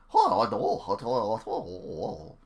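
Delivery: botched Scottish ogre voice, loud start; Shrek. Acted. Streamer guessing how Shrek sounds, bad Scottish impression. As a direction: blurt out a fumbling attempt at a Scottish ogre. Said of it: "Well, he's Scottish, isn't he? So he's like".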